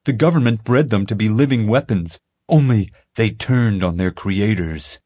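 hammed-up insistent boy; conspiracy kid. A narrator's reading. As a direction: sell the secret like it's gospel. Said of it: "Ethan told him".

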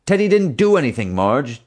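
high, loud desperate yell. Acted. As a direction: yell it in protest. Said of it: "Dad yelled".

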